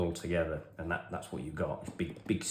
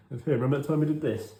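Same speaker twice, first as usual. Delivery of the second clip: high, louder teasing call; teasing mate; 7 semitones higher, 6 dB louder, faster than usual. Retold or imitated. Best mate reminding him of the fist bump, teasing. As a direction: needle your friend gleefully. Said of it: "my mate likes to remind me".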